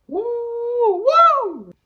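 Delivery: high, loud celebratory woo; hype yell. Real, not acted. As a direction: whoop it up like a hype man. Hype shout after question.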